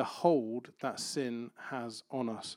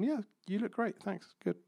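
fake reassurance; 8 semitones higher, 4 dB quieter than usual. Friends falsely reassuring.

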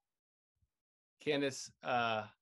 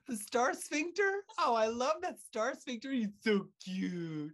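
cutesy cooing; 8 semitones higher than usual. Pretend. Host doting on his invented pet species, cutesy cooing. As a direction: gush over it like an adorable little pet.

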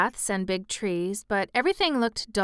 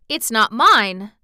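slow, louder firm Yorkshire; stoutly; 8 dB louder, slower than usual. A narrator's reading. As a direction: stand firm on it. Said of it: "answered Martha stoutly".

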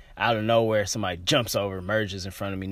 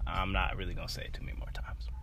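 whisper; 3 semitones lower, 6 dB quieter, faster than usual. Real, not acted. Fed up, warning the crowd.

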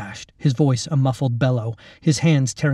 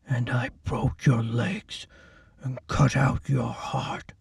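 slow, softer threatening voice; quiet menace; 6 dB quieter, slower than usual. Acted. Orderly describing his cruelties to the girl, quietly menacing. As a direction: lean in and murmur the cruelty.

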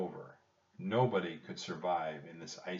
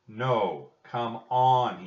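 loud, raised-pitch urging; urgent insistence; 3 semitones higher, 8 dB louder, much slower than usual. A narrator's reading. Jack trying to pull Annie out of the boat, urgent. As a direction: press hard on a short command.

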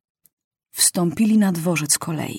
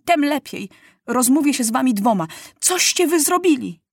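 angry demand; 6 semitones higher than usual. A narrator's reading. Furious boy confronts journalist.

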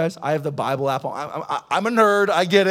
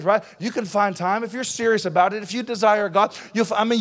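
normal talking voice

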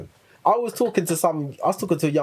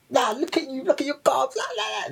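high, sing-song playground jeer; squeaky mocking imitation of bullies; mock taunting; 11 semitones higher than usual. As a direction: tease like kids cussing someone out. Acts out kids cussing you.

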